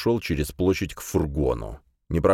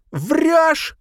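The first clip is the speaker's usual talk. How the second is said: high, loud gasping scream; breathless scream; 6 dB louder than usual. A narrator's reading. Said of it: "закричала она, задыхаясь".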